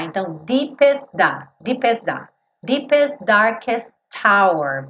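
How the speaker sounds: deepest darkest with the final T swallowed; dropped T. Shown as a demonstration.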